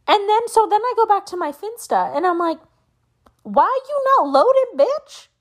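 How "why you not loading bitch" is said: high, loud mock yell at the phone; mock yell at app. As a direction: snap at the app like it's a person. Yelling at app in frustration.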